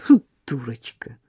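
scornful thought. Acted.

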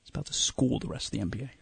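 low hushed serious whisper. Acted. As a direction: whisper it like grave news. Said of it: "Why are you whispering it?".

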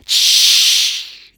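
loud hissed shush; irritated hiss. A narrator's reading. Annoyed anglers in the bushes, hissing for silence. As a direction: shush them sharply with annoyance. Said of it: "pełne irytacji syknięcie".

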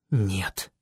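low, hushed disbelief; whispered. A narrator's reading. Boy seeing the lone rider, whispered. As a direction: barely voice it, sinking. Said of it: "прошептал я".

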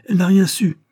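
loud, high protest; indignant cry, pitch leaping up. A narrator's reading. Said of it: "s'écria-t-il".

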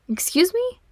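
indignant, high pitched Excuse me; playfully outraged, jumping up high; mock offence. A narrator's reading. Brother mock-offended at little brother.

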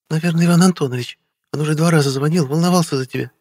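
высокий женский голос в диалоге; сообщи тонким, заметно поднятым голосом; Вера. A narrator's reading. Narrator voices Vera in dialogue. (женская реплика в высоком регистре)

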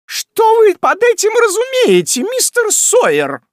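very high, loud piercing screech; пронзительный крик. Acted. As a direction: pierce the room with an angry landlady's shriek. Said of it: "пронзительный голос". (пронзительный визгливый крик)

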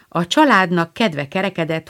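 ordinary delivery, nothing marked

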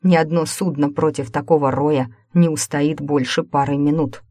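plain reading voice

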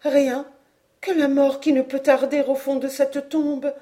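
high-voiced despair, not hushed; despairing murmur. A narrator's reading. Aunt answers that only death awaits, despairing. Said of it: "murmura madame Caudal".

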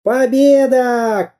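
high, drawn-out triumphant shout; victory cry. A narrator's reading. Samodelkin cries victory while beating the pirate, triumphant. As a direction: stretch the cheer slowly. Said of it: "кричал Самоделкин".

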